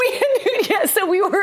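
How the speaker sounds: high, laughing through words. Real, not acted. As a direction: giggle while you answer. Laughing at the bad live hit.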